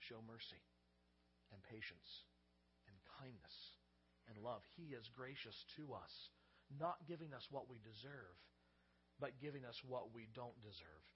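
quiet, tender pulpit reflection; hushed sermon. Acted. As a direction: let each word land gently. Soft reflective preaching.

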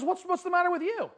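acted high-pitched query; wife's startled question. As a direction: ask it high and puzzled. Acted wife questioning her husband.

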